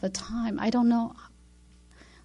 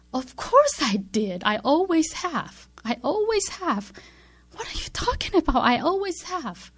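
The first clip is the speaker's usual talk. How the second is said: louder, higher, insistent protest; an offended heart insisting it always has; indignant protest; 6 semitones higher, 4 dB louder than usual. Retold or imitated. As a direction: defend yourself, indignant and hurt. Heart protests to God's question.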